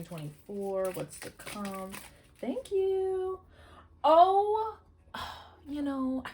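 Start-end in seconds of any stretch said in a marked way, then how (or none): 2.4-6.2 high, airy stunned reaction; amazed gasp. Real, not acted. Tarot reader stunned by the card she just pulled, high and amazed. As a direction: gasp at the card in real awe.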